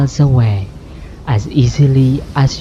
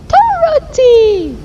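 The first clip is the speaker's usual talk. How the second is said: screamed. Acted. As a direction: call out for the lost girl in alarm. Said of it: "Aunt Em screamed".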